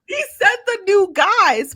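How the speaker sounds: amused disbelief, laughing as she speaks. Real, not acted. Mocking a contestant's logic, laughing out loud.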